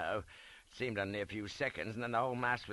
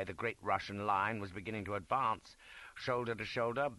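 plain reading voice

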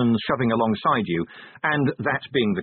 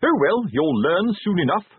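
high, breezy sergeant voice; breezy teasing sergeant; 8 semitones higher, 4 dB louder than usual. A narrator's reading. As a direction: brush off the recruit's worries. Fred dismisses recruit's protest.

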